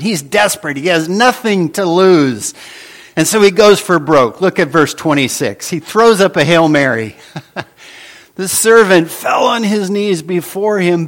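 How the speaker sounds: normal talking voice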